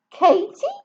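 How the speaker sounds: high, indignant denial; feigned innocence. Acted. Katie denying her name to the man on the phone, high pitched. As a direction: play dumb loudly.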